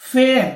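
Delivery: फेयर said loudly with an ए gliding into an आ; air sound demo. Shown as a demonstration. Teacher showing the same glide in फेयर. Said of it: "इसी तरह से".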